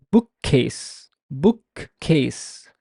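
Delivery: "bookcase" with one long, doubled k across the join; prolonged K. Shown as a demonstration. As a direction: hold the k closure in "bookcase". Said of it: "The k sound is stressed, is prolonged".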